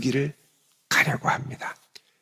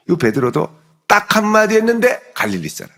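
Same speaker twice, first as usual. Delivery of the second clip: usual speaking voice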